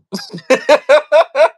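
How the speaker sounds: sudden loud cackle; loud high laugh. Real, not acted. Laughs after joke.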